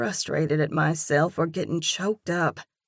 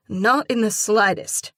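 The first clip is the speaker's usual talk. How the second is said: heated denial; 4 semitones higher, 6 dB louder than usual. A narrator's reading.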